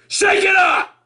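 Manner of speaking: sudden high exclamation; shout. Pretend. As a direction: yell it like a wake-up jolt. Urging a violent wake-up call.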